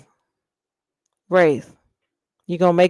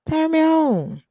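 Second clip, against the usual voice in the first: higher, teasing turn me on; playful swooning repeat; high mock swoon; 10 semitones higher than usual. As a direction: coo it up high.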